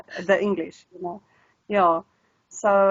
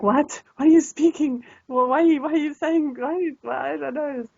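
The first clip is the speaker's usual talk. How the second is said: higher, baffled protest; baffled disbelief; 7 semitones higher than usual. Acted. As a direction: act out bewildered listeners hearing a strange accent. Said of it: "The poor Afrikaans people are like".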